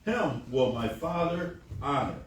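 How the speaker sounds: ordinary talk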